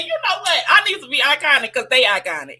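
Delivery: lightly hammed-up proud proclamation; playful self-declaration. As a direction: announce it with comic self-importance. Quotes own playful declaration.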